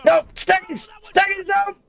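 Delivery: very high, panicked yelling; panicked shout. Real, not acted. Streamer yelling at her fighter caught in a choke, panicked shout. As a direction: scream at the fighter to escape.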